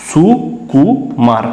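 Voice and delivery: সু, কু, মার as three separate beats with pauses; broken into syllables. Shown as a demonstration. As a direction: break the word into সু ... কু ... মার. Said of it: "এটাকে তিনবার ভেঙে উচ্চারণ করতে হলো".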